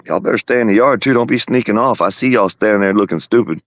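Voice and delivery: scolding warning. Real, not acted. Owner warning the animals to stay in the yard, scolding.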